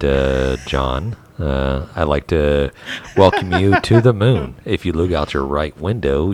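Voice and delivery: loud, steady cabin announcement voice; flight attendant. Pretend. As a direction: announce it like cabin crew over the PA. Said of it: "give me your best impression of a flight attendant".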